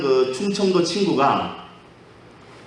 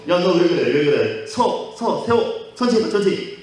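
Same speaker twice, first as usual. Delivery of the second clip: startled, scared pleading; 3 semitones higher than usual. Acted. Passenger begging his speeding friend to stop, scared. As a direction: act out a frightened passenger, pitch jumping up. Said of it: "놀래가지고 무서워서".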